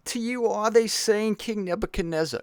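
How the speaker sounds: high-pitched, subdued messenger from heaven; Woody Allen. Acted. Heavenly messenger addressing the king, Woody Allen-like. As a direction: play the messenger as a comic impression. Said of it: "this is literally the voice of the messenger".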